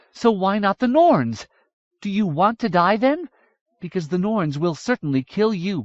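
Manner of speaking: heated princess challenging the Dwaro; fierce young woman pleading. A narrator's reading. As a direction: argue hard to make him fight. Miriamel's long plea voiced.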